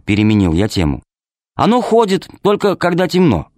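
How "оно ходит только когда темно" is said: put-on high childlike voice, eager; girl's voice. A narrator's reading. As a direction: voice a little girl, high and earnest. Child Alice answers father. (голос девочки)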